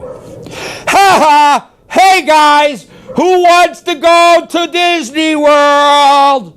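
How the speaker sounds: loud, high and slow hollering; high, drawn-out loud call to the dogs; excited yell. Shown as a demonstration. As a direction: whoop it up like announcing a treat. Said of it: "Watch when I yell".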